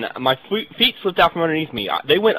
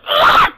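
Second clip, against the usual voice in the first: sudden, very high and much louder grunting yell; mock gym yell; 12 dB louder than usual. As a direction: shout out on every rep to get noticed. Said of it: "I'm just like".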